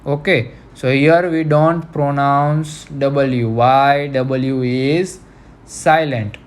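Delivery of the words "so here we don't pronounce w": normal talking voice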